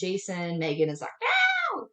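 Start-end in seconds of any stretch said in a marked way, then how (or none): 1.2-1.9 very high drawn-out squeal; piercing imitation of a shrieked refusal; mock scream. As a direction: squeal it in protest, hammed up. Retelling Megan screaming no.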